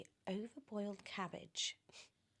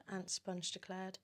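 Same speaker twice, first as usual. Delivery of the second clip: ordinary delivery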